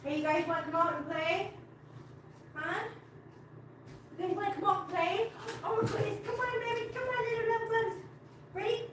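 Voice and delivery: high, soft pet-talk voice; coaxing cats. Real, not acted. Owner inviting her kitties out to play, high soft coaxing. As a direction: sweetly coax shy pets out.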